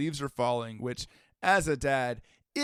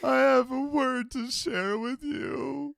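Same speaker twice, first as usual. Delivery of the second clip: high, slow hammed-up wail; mock tearful; 11 semitones higher, 4 dB louder, slower than usual. Co-host with a word to share, mock-weepy. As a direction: whine it as if close to sobbing.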